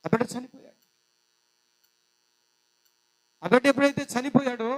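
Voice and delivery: loud, rising sermon delivery; preacher building to a loud climax; full-force preaching. Acted. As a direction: proclaim the child's death to the congregation.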